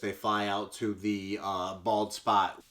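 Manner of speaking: ordinary talk, nothing marked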